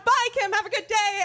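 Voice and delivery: high, loud breezy farewell; overbright goodbye. Retold or imitated. Girl waving off the cheerleader after the mix-up, brightly fake-casual. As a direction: pretend nothing happened, chirpy. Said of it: "and I went".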